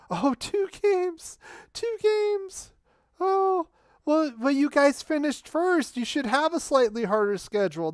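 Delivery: mock their complaint in a high, sneering tone; sarcastic mockery. Real, not acted. Sarcastically mocking the rival fan.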